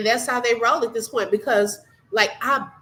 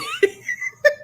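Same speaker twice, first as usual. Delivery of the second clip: laughing until near tears; high crying laugh. Real, not acted. Streamer retelling Lola's comment, crying with laughter. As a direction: squeal it out.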